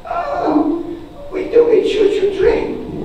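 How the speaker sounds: very high-pitched child impression; little-kid voice. First grader telling teacher about vacation, high little-kid voice.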